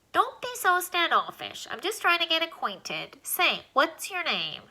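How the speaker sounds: a lightly squeaky little alien, pleased with the rooms. Acted. Alien lists the rooms in Alan's nose, lightly squeaky and pleased.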